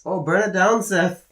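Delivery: hammed-up moan in a poor Irish accent; attempted Irish accent.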